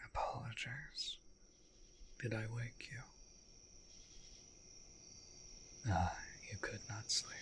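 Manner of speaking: soft, gentle night-time murmur; hushed apology. Acted. Vampire to waking companion who could not sleep, hushed. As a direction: speak softly to someone just woken. Speaking to one just woken.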